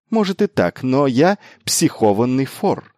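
high start, emphatic finish; bold, proud comeback; defiant retort. A narrator's reading. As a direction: own the insult with bravado. Miles retorts proudly to the insult.

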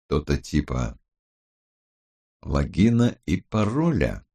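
lightly voiced low, puzzled criminologist; Гаран. A narrator's reading. Criminologist asks about logins, not understanding, in a low voice. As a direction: start low and ask tentatively. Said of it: "спросил Гаран".

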